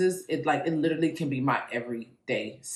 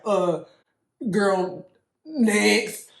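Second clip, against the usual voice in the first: dismissive, loud and sassy; sassy dismissal; 7 semitones higher, 6 dB louder, much slower than usual. Retold or imitated. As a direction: wave it off, unimpressed. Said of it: "Y'all were like".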